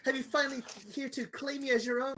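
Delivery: high, fast, gushing woman; eager tabaxi. Acted. Tabaxi woman gushing at Augie, high and fast. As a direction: gush at him, rushing the words up high.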